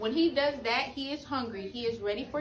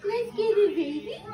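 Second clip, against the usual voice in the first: sudden loud hype cheer; loud excited cheer; 9 semitones higher, 6 dB louder than usual. Real, not acted. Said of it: "I don't mean to scare my baby".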